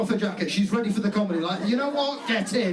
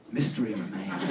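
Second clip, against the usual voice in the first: much lower, hushed mystic voice; mysterious clairvoyant; 11 semitones lower, 4 dB quieter than usual. Retold or imitated. Comic imitating a quiet woman as the bar's clairvoyant, much lower and mysterious. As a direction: intone it like a fortune teller. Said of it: "they're like".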